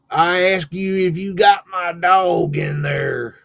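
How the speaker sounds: imitating an irate farmer, every word stretched; old farmer demanding. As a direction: repeat it slowly, insistent old country farmer. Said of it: "he was old school farmer".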